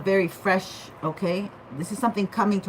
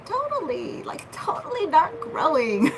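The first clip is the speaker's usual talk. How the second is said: hammed-up Valley girl, much higher; 9 semitones higher than usual. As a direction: overdo the Valley girl on totally. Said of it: "I sound like a Valley girl".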